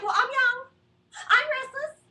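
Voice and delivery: high, loud protest; indignant retort. Retold or imitated. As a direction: fire back at mom.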